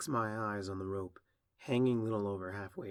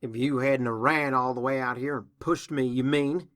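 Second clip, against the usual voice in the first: indignant retort; 3 semitones higher, 8 dB louder than usual. Acted. Applejack answers her sister's blame, indignant retort. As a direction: throw the blame back forcefully.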